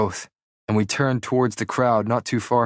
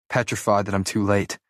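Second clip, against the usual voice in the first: usual speaking voice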